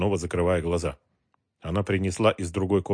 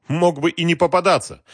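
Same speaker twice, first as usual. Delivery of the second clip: contemptuous; 9 semitones higher, 6 dB louder than usual. A narrator's reading. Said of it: "презрительно заметил Галкин".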